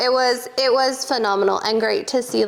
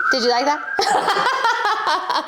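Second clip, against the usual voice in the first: laughing; 5 semitones higher, much slower than usual. Real, not acted. Laughing at own goal story.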